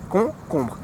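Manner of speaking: concombre with nasal kon-kon and a soft R at the end; teacher modelling concombre; French concombre. Shown as a demonstration. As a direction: repeat the kon sound twice, then bre. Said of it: "You just repeat it".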